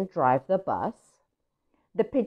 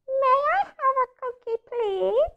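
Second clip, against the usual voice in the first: much higher, tiny duckling voice; smaller voice. Acted. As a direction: shrink the voice down to a tiny chick. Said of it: "it's a smaller voice".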